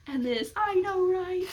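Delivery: very high, slowed, teary fangirl squeal; crying squeal. Pretend. Fan overwhelmed with a fellow reader, teary squealing. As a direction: squeal it like you're about to cry. Imagined fan screaming together.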